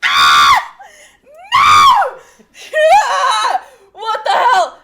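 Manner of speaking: loud high shrieks breaking into laughter; hammed-up freak-out, half laughing; panicked screaming. Acted panic at undead friend.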